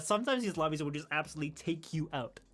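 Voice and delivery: normal talking voice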